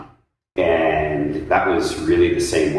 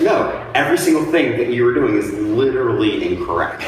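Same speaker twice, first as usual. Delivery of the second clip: exasperated correction; 7 semitones higher, 3 dB louder than usual. Retold or imitated. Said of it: "I'm like".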